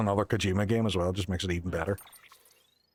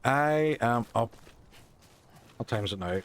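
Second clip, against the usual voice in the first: normal talking voice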